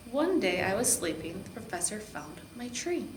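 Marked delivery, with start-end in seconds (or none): none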